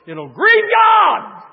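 loud, high, drawn-out cry; slow emphatic shout. Acted. Preacher warns the church about unforgiveness, slow and loud. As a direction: stretch every word out in a shout.

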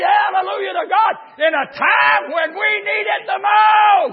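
high, roaring revival shout; preaching at full force. Real, not acted. Preacher tells the church God gives in time of need, shouting. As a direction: belt out every phrase, stretching key words.

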